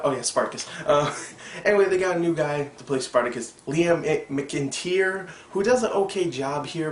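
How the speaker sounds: ordinary talk, nothing marked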